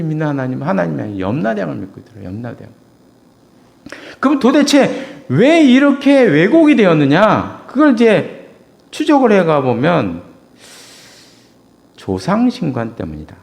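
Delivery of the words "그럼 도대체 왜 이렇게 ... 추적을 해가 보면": loud, slow rhetorical challenge; slow preaching question. Acted. Preacher challenging the congregation, slow and loud. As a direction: hold the hall with the question.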